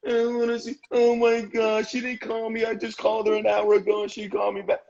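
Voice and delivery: high, whining mock complaint; whiny complainer. Man mocking a whiner to his host, high whine. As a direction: whine like you can't face the morning. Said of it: "whine all the time".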